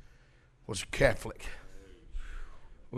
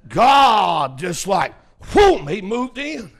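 sudden exclamation; 3 semitones higher, 7 dB louder, slower than usual. Retold or imitated. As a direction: burst out like a power sweeping in. Preacher acting out God's sudden move.

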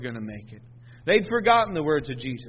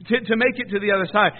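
normal talking voice

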